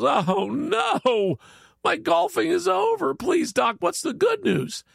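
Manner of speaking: high, squeaky cry of dismay; high-pitched whiny despair, played for laughs; panicked golfer. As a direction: wail it like a golfer losing his game. Golfer panics in the told joke.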